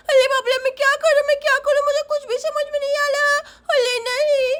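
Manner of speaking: put-on very high, lisping child in dismay; panicking little Sonam. Daughter sees father is a ghost too, panicky child voice. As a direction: wail it quickly as a scared small girl.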